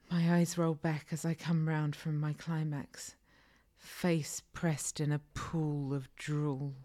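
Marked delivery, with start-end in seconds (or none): none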